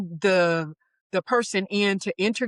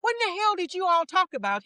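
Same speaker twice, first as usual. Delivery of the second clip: amazed high quote; 10 semitones higher, faster than usual. Retold or imitated. Manager calling back amazed.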